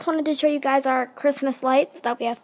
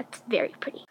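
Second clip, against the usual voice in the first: whisper; 3 semitones lower, 7 dB quieter, faster than usual. Real, not acted. Admiring the lights outside.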